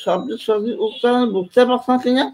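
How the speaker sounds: slow, muffled words with the tongue held; garbled speech, tongue held; tongue held still. Shown as a demonstration. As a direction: talk with your tongue stuck in place. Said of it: "এই আটকে দেন".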